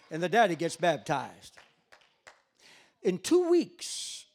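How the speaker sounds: loud, punched revival preaching; full-force preaching. Real, not acted. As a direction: drive each baptism home from the pulpit.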